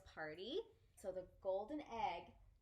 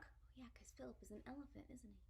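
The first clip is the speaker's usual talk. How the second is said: quick hushed aside; whispered aside; 13 dB quieter, faster than usual. Real, not acted. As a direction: lean in and whisper. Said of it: "whispered aside".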